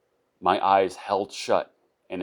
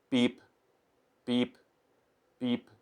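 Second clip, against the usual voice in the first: imitating beeps; 6 semitones higher, 3 dB quieter, slower than usual. Imitating hospital machine.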